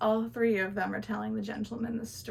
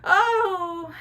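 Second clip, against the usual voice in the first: mother's tearful cry; 9 semitones higher, 7 dB louder than usual. Acted. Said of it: "And the mother goes".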